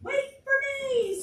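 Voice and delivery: high squeal of a little pig; squealing piglet. Acted. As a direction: squeal to be waited for. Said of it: "squealed Little Pink Pig".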